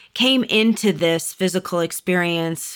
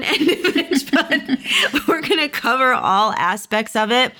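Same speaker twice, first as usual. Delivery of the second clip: talking through a light laugh; 6 semitones higher than usual. Real, not acted. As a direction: chuckle through the words. Laughing about the crone.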